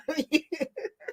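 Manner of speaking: trailing fit of laughter; laughing. Real, not acted. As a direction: laugh it out after the joke. Laughs after the hair joke.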